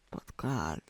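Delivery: low awed whisper; breathless whisper. Acted. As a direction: let it slip out on a breath. Reacting to the kiss.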